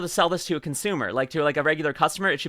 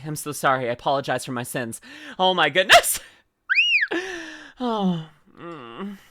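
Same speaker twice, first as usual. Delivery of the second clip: ordinary delivery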